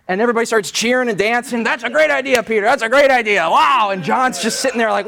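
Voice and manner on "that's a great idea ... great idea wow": much higher, louder gushing praise; mock enthusiasm. Apostles praise Peter's plan in hammed-up excitement. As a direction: gush like a thrilled fan.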